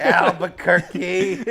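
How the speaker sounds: put-on slow, loud, laughing Boston accent. Saying city in Boston accent.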